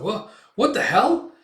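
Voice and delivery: high, loud confused yelp; startled outburst. Retold or imitated. Soldier startled by the smoke bomb, loud high yelp. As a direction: jump at the sudden smoke. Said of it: "it's like".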